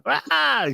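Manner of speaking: high mock blare; sudden high "ah" imitating the speaker going off; imitated blaring noise. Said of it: "I, like, put it there".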